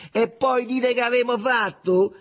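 high, loud, amused retort; amused outburst. A narrator's reading. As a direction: throw it back at them gleefully. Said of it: "E continuava a ridere".

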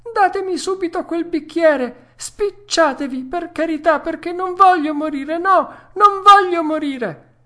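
high, loud desperate pleading; panicked shrieking. Acted. Puppet begging for the glass, shrill-high panic. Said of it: "cominciò allora a strillare il burattino".

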